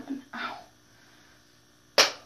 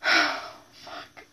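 normal talking voice

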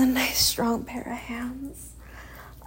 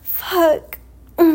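usual speaking voice

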